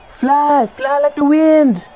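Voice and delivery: high, slow, drawn-out cheer; will them to fly; dramatic cheering. Acted. Cheering at the game.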